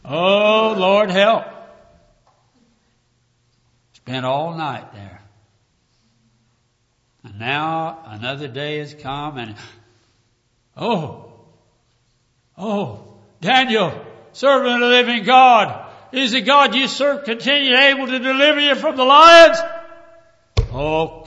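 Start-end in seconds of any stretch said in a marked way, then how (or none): 0.1-1.4 loud, raised plea for help; crying out. Acted. Mock plea from the den. 10.7-19.6 high, loud anguished call; lamentable cry. Acted. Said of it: "cried with a lamentable voice".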